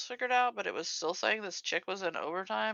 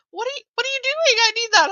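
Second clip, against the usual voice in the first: higher, louder, fast alarmed question; startled coworker; 12 dB louder, faster than usual. Retold or imitated. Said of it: "And the girl's like".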